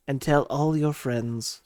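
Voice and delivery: soft, slow, smooth radio host; NPR voice. Pretend. Host plugging the podcast, mock NPR voice. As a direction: croon it silkily, hushed and unhurried. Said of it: "I put on the N P R voice".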